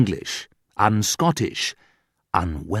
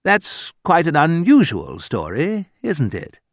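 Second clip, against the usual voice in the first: polite English doubt; 7 semitones higher than usual. Retold or imitated.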